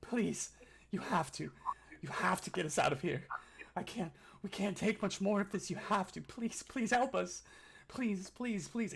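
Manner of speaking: high, desperate begging through sobs; sobbing plea. Acted. Chained prisoner sobbing to his would-be rescuers, desperate pleading. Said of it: "sobbing and just says".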